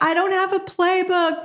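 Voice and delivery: high-pitched fretful voice; mock worried whine. Listener fearing they have no playbook, high whine. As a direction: play the listener's anxious doubt.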